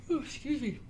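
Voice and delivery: said through a yawn. Real, not acted. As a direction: yawn while talking. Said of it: "you're yawning".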